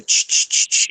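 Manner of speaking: sharp whispery static bursts, "ch-ch-ch-ch"; imitated spirit-box noise. Asking the neighbor about the spirit box noise, imitated static. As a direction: imitate choppy radio static with the mouth. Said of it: "Why is it so noisy".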